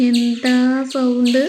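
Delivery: stretched-out amazed outburst; amazed exclamation. Real, not acted. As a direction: marvel out loud at the noise. Amazed at loud squirrel cries.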